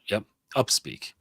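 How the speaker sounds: usual speaking voice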